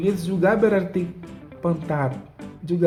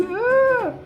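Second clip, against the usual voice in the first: much higher exclaimed ah; high exclamation. Real, not acted. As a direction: let out a high startled ah. Exclaims at the funny word.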